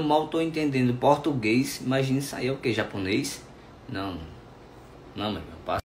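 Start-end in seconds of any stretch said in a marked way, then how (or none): none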